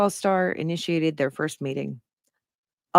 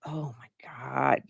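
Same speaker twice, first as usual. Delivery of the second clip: slow breathless whisper of disbelief; stunned whisper; 3 semitones lower, 8 dB quieter, slower than usual. Real, not acted. Host reacting to the story in a slow disbelieving whisper. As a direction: breathe it out, floored.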